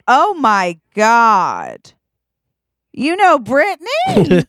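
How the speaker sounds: drawn-out, swooping amazement, climbing high; starstruck disbelief. Acted. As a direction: gush in hammed-up astonishment. Acted starstruck reaction in story.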